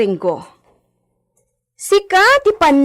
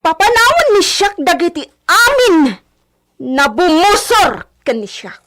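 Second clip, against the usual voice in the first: angry commands; 3 semitones higher, 5 dB louder than usual. Acted. Orders the servants off the hacienda.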